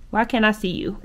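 ordinary talk

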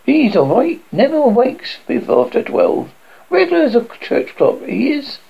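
a reassuring mother in a lilting voice; chatty bargewoman. Acted.

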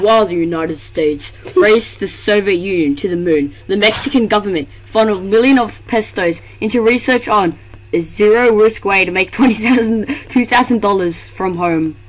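lightly deep movie trailer narration; grand trailer announcer read; epic voice. Acted. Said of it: "I'm gonna say this in my epic voice".

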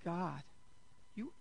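hushed, amazed thanks to God; awed near-whisper. Retold or imitated. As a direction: let the words fall away in astonishment. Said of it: "It was just like".

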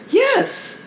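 ordinary talk

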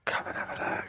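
secret unvoiced whisper. Real, not acted. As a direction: whisper as if nobody must hear. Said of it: "Stop whispering".

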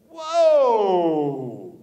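loud drawn-out "whoa", falling in pitch; long shouted whoa. Real, not acted. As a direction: bellow a long sliding whoa. Sudden exclamation to the audience.

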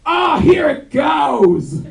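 slow, high, loud strained cry; mock climax shout. Comic imagining Kel's climax, shouting it slowly. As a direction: belt it out, dragged and strained. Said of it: "whispers in his lover's ear, very softly".

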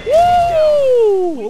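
high loud whoop; victory whoop. Real, not acted. Gamer whooping after a kill. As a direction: whoop in triumph.

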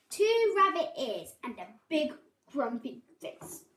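ordinary delivery, nothing marked

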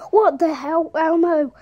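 loud startled outburst at the puppet; shocked shout. Acted. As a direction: shout in disbelief. Startled by Elmo talking.

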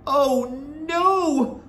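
high wail of dismay; crying out. A narrator's reading. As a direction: cry out as a dismayed boy. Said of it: "I cried".

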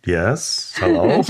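lightly voiced gnome, hello rising. Gnome answering the hiker, lightly acted puzzled voice. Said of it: "And the gnome said".

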